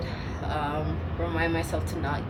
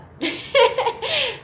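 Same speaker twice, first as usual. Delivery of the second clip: high laugh; 6 dB louder than usual. Real, not acted. Laughing at herself.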